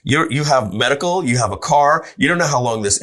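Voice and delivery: wife warning she won't let him quit; wife objecting. Retold or imitated. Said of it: "She's like".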